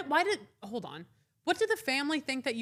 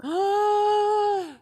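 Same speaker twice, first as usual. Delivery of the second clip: shocked gasp; 10 semitones higher, 5 dB louder than usual. Real, not acted. Listener shocked by the insult.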